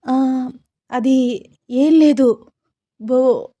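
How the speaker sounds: high, halting, stumbling over the words; flustered stammering. A narrator's reading. Sita covering up her scream before the elders, stammering. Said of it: "అని తడబడుతూ మాట్లాడుతోంది సీత".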